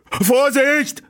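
high, loud warning yell; roar. Acted. Bob yelling a warning to the riders, loud and high. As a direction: bellow a warning. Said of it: "brüllte Bob".

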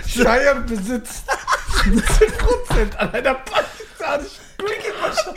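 high, laughing through the words; barely gets it out laughing. Real, not acted. Retelling joke while cracking up.

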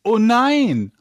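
high hammed-up oh no; mock dismay. Acting dropped level mishap.

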